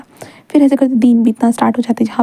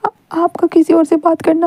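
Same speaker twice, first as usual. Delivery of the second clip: halting tearful confession; 5 semitones higher, slower than usual. A narrator's reading. Crying boy confesses jealousy.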